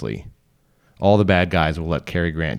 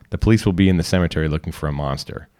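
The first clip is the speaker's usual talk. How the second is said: ordinary delivery, nothing marked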